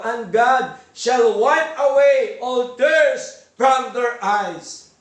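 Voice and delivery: slow, measured reading of the verse; slow, solemn sermon recitation; solemn scripture reading. Acted. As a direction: read it out word by word with weight. Reads scripture slowly, solemnly.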